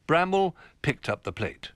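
plain reading voice

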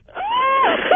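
a high, wild shriek of a laugh. Real, not acted. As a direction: let a crazed cackle rip. After her rant, manic cackle.

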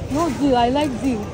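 normal talking voice

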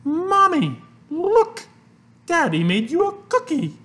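put-on high, loud little boy calling; excited child. A narrator's reading. Christopher shows Mommy a cookie, excited child. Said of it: "went upstairs and said".

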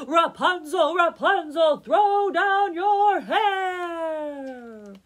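high full-volume shout up a tower; comically long, loud distant call; calling from afar. A narrator's reading. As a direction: holler it, stretching the last word out long. Prince shouts up from far below.

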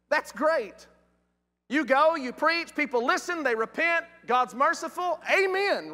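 high, upbeat list building to "Amen"; delighted enthusiasm. Acted. Preacher thrilled that people listen and repent, high and excited.

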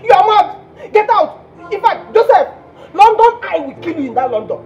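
high, loud outraged tirade; explode, then pile curse upon curse; furious cursing. Acted. Jilted caller cursing Joseph in London, loud high fury.

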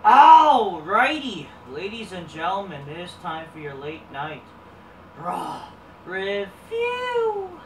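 drawn-out announcer opening; stretched-out host welcome; show intro. Acted.

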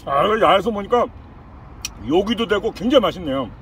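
speaking between bites; talking while chewing. Real, not acted. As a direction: mouth partly full of pizza. Seen 4: holds pizza slice, jaw moving with food while speaking.

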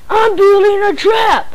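loud high bratty shout. A narrator's reading. Defiant boy announcing his trap, shouting. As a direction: yell it proudly at them. Bratty son declares plan.

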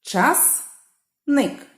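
Часник in two beats, Час, ник; Часник pulled apart syllable by syllable; split into syllables. Shown as a demonstration. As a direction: clear pause between Час and ник in Часник. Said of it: "Ділимо на склади".

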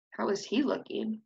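ordinary delivery, nothing marked

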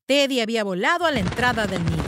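ordinary delivery

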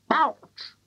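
very high "Ouch"; hen's squawk. A narrator's reading. Brown hen reacting to the medicine, high squawk.

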